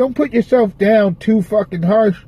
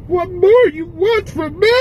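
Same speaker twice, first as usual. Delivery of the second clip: much higher, slower, pleading cry; high desperate plea; 10 semitones higher than usual. Real, not acted. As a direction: beg the heavens, voice climbing high. Giving up, pleading to the sky.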